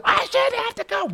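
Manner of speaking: squeaky, high whiny protest; high mock-whiny woman demanding why she must leave; shrill protester voice. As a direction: mimic a whining protester in a shrill falsetto. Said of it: "and the cops say".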